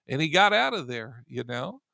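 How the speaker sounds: normal talking voice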